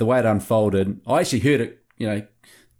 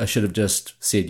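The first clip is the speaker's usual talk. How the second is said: ordinary talk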